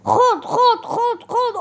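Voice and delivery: high, loud gamer cries; "خد" yelled high and loud in triumph; excited. Real, not acted. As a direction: shout it as you land each hit. Attacking in game, excited.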